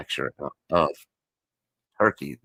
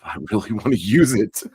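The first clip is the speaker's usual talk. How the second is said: higher, louder, through real laughter; laughing admission; 7 semitones higher, 6 dB louder than usual. Real, not acted. Crafter confesses she wants to keep the yarn, laughing. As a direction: let the laugh spill over the words.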